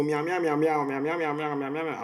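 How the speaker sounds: rapid-fire mimicking of unintelligible babble; mock foreign chatter. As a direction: imitate girls jabbering in a foreign language. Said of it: "they were all, you know".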